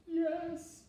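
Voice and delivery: high little voice; a little monster admitting it; small monster. Acted. Billy Monster answering his father, high.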